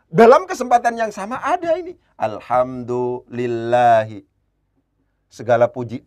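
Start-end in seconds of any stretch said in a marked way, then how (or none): 2.2-4.2 slow recitation. Shown as a demonstration. Teacher reads Arabic example slowly.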